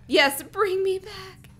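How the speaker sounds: put-on high, slow, feeble girl's voice; weak plea. A narrator's reading. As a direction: plead weakly, drawing it out. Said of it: "Aaron said weakly".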